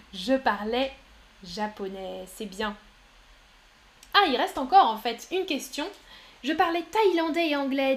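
usual speaking voice

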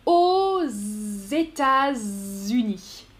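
"Aux États-Unis" slow, a z linking aux to États; liaison demo. Shown as a demonstration. Teacher shows a student the liaison, slowly. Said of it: "on fait la liaison".

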